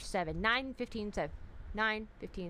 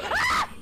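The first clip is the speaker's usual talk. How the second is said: sudden high shriek of fright; startled scream; 6 dB louder than usual. Real, not acted. Streamer jump-scared by a monster, loud shriek.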